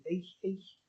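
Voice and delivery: jokey token Scouse; attempted Scouse accent. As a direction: slip in a quiet Scouse catchphrase. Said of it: "Scouse accent".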